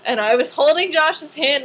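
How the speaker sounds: ordinary talk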